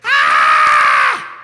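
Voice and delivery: long loud yell of pure panic; full-throated scream of terror; terrified scream. Retold or imitated. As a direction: scream like a shark has you. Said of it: "Something like this".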